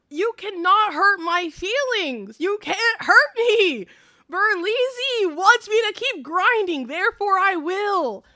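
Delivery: high, drawn-out emphatic declaration; triumphant declaration. Real, not acted. Streamer elated by her idol's encouragement, triumphant declaration. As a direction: proclaim it slowly and high, as if untouchable.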